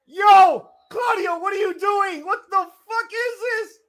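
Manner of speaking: very high, loud disbelieving shouts; shocked yelling. Real, not acted. Shocked at Claudio's move.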